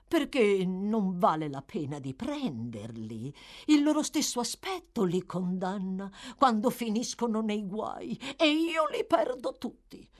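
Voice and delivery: put-on swooping, high-peaking old schemer. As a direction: justify the scheme, wheedling.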